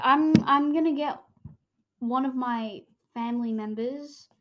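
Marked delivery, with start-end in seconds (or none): none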